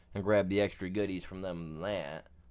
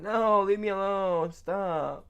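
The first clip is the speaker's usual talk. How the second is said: high, pleading cry of someone fleeing; hammed-up frightened runaway yelling stop; mock panicked plea; 10 semitones higher, 5 dB louder, slower than usual. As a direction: beg them to stop like you're running for your life. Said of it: "one of them guys that are running away going".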